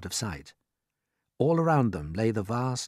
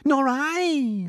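Miss Spider; 6 dB louder than usual. Acted. Miss Spider joins the panic.

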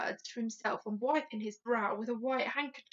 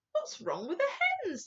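higher-pitched royal question; imperious Queen; 8 semitones higher than usual. A narrator's reading. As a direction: demand an answer as the Queen. Queen questions her butler.

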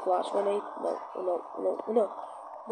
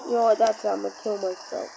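usual speaking voice

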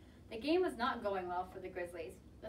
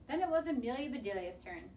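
ordinary delivery, nothing marked

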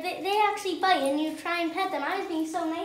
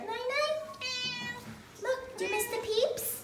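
pinched voice; 7 semitones higher, slower than usual. Real, not acted. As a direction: coo at the cat in a squeezed voice. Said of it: "you change voices when you talk to her".

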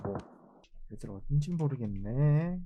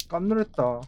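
normal talking voice

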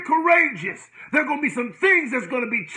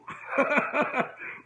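breathless laugh; 2 semitones higher, 7 dB quieter than usual. Real, not acted. Preacher laughing after a point.

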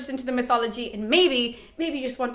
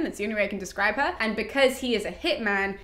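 normal talking voice

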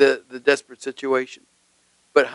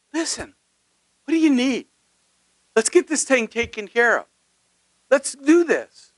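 higher, kindly eager offer; not angrily; 9 semitones higher than usual. Retold or imitated. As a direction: gently invite her to ask. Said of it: "he didn't say it angrily".

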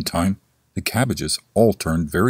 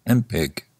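plain reading voice

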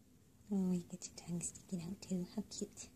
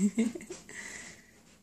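warm mom laugh; 3 semitones higher, 6 dB louder than usual. Real, not acted. Fond laugh at silly pet.

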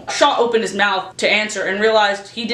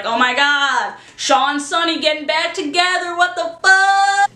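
mock tabloid gasp; 6 semitones higher than usual.

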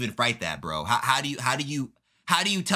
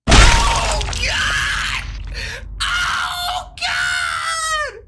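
far higher, louder shriek; anguished screams; 8 dB louder, much slower than usual. Acted. Fighter screaming at the knockout blow, anguished.

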